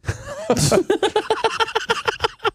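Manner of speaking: long high giggling laugh; high-pitched laugh. Real, not acted. Laughing at the prank caller.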